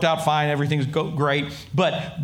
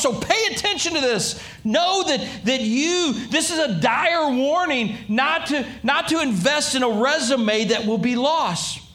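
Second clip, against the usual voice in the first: ordinary talk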